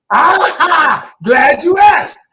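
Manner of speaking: loud, laughing, delighted; excited shout. Real, not acted. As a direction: burst out with glee at the question. Coach delighted by the question.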